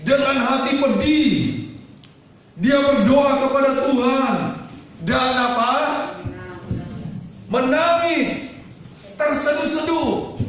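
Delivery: high, emphatic pulpit reading; scripture reading. Acted. Preacher reads the verse to the congregation, high and emphatic. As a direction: read out the verse, stretching the key words.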